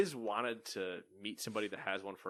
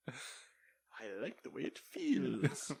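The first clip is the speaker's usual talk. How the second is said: raccoon voice; 5 dB quieter, much slower than usual. Retold or imitated. Raccoon voiced holding food.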